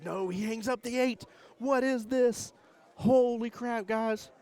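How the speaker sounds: real stunned excitement, high and loud; shocked yelling. Real, not acted. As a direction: yell it out as the game flips. Shocked by the hung eight ball.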